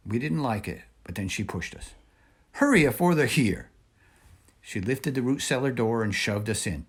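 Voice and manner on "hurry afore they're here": put-on higher, louder voice of an old woman giving orders; an old woman pushing the children to safety. A narrator's reading. Momo Bay again.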